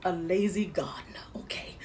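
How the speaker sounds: whispered confession. Real, not acted. Confessing to viewers she can be a lazy gardener, whispered. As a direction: whisper it like a guilty secret. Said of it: "sometimes I can be".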